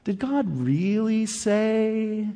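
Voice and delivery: slow, hushed, sly tempter voice; a slow, quiet, insinuating devil asking a question; sneaky devil. Acted. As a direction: drag it out like a snake whispering doubt. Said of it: "He's sneaky".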